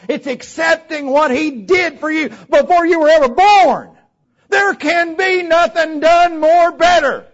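high, forceful pulpit delivery building to a shout; fiery sermon climax; impassioned preaching. Real, not acted. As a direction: preach it with rising fervor.

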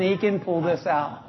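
ordinary talk, nothing marked

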